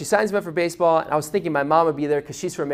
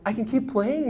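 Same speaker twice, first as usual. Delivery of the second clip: put-on little kid voice; 5 semitones higher than usual. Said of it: "like a little kid".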